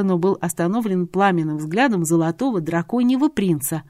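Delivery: ordinary talk, nothing marked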